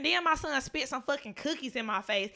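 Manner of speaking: ordinary delivery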